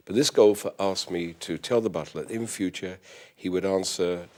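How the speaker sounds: normal talking voice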